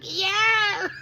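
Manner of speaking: high hammed-up yell; mock shouted yeah. Lio Rush answering back, hammed-up high yell. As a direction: bark it out like a cocky wrestler. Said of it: "And he's like".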